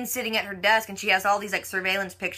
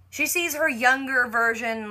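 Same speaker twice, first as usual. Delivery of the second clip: ordinary delivery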